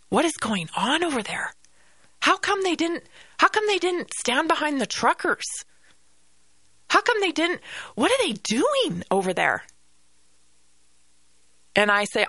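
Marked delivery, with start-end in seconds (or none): 0.1-9.6 high-pitched incredulous questioning; imitating baffled Americans. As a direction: mimic bewildered people demanding an explanation. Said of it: "they say".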